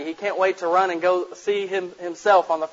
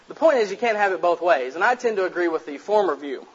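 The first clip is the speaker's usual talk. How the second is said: ordinary delivery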